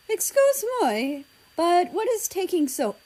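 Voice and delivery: a light touch of a busy mother; mildly voiced mother. A narrator's reading. France asking what's taking so long, mild impatience.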